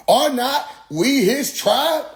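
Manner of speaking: high, slow mock-preacher question; imitated preacher challenge. Preacher challenging a doubter about the tribe, high and slow.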